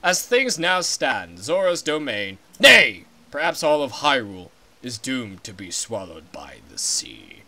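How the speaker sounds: a deep and solemn giant fish king; stately Zora king. Acted. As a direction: speak slowly like an old king. King speaks again.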